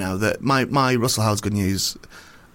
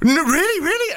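excited fan. Retold or imitated. Fan excitedly recognising him.

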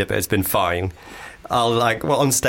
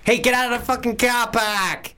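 higher, raspy whispered Boston threat; whispered Boston movie tough guy; Boston accent; 13 semitones higher, slower than usual. Pretend. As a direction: hiss it like a Departed cop, much higher. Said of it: "The Departed".

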